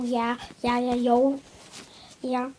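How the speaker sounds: usual speaking voice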